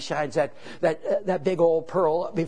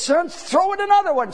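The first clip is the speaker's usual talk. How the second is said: excited call; 11 semitones higher, 3 dB louder than usual. Retold or imitated. Juggler shows off to boy.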